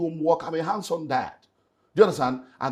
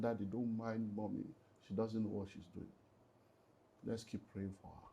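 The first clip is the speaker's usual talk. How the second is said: put-on much softer, tender child's words; quoting young daughter; 4 semitones lower, 14 dB quieter than usual. Telling the host what his eight-year-old daughter said, soft and tender. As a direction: hush it like a small girl comforting her father. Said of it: "my daughter was eight years old and came and said".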